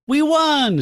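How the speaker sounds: high, loud victory cry; acted-out shout of triumph; exultant. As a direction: cheer the win out loud. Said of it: "just exultantly like".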